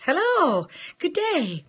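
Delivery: high, lilting hello, good day; mock posh greeting. Host greets UK viewers mid-story, lilting and high. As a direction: tip the hat with a lilting hello.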